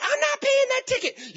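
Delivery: high mocking woman's protest; indignant woman. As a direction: squeal like an outraged driver. Woman defying the judge.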